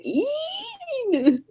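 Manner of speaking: very high, soft, held-out end of the word; imitating drawn-out child whine. Friend mimicking how the girl drags out mean. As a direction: let the last sound trail up like a sulky child. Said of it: "You go to care out the".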